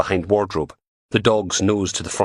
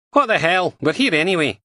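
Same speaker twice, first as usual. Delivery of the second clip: higher, brisk Scottish policewoman voice; PC Martin voice; 11 semitones higher, 4 dB louder than usual. Acted. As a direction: voice her as a blunt, scornful dog handler. Martin shrugs it off.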